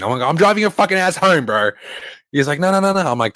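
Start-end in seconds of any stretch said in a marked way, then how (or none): 0.2-1.7 raised, higher-pitched exasperated retort; retold angry outburst. Retold argument with friend. 2.6-3.1 high, quick string of pleading refusals; mock panicked protest. Friend's protest in retold quarrel.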